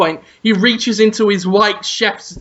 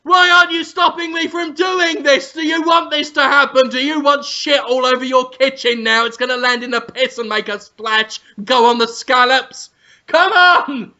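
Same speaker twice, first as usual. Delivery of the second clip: high, goading chef rant; hammed-up indignant yelling; mock outraged shouting; 5 semitones higher than usual. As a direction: demand to be stopped in mock fury. Acted Ramsay goading maitre d'.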